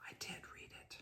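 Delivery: insistent whisper. Retold or imitated. Reader whispering back to the rector in church, insistent. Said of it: "And Roy York said".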